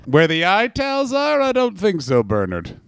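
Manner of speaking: mock old man's refusal; grandfather's crotchety friend. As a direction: dismiss it like an old-timer. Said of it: "one of them went".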